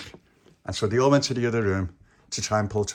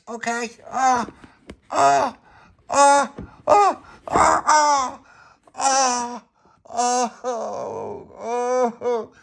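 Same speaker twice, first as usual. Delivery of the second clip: high wails, loud then easing to moans; acted crying; 14 semitones higher, 7 dB louder, much slower than usual. As Pauline pulls with all her strength, acted crying. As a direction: wail through a long painful pull. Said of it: "Don't be crying".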